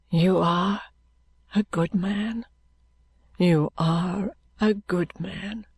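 slow, faint, breaking voice; tearful. A narrator's reading. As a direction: voice giving way to tears. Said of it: "and burst into tears".

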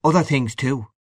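mildly curt; short, curt. A narrator's reading. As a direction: answer shortly. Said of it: "said little Chandler shortly".

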